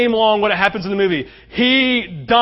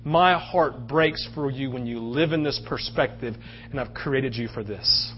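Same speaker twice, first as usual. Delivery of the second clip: low, soft and slow, heartfelt; tender, emotional; 6 semitones lower, 7 dB quieter than usual. Retold or imitated. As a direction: voice God speaking gently to a hurting child. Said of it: "there was emotion because he said".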